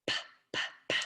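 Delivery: a breathy "P, p" with no voice, just air; voiceless p sounds. Shown as a demonstration. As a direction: puff out the bare p sound. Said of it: "what do we hear at the beginning".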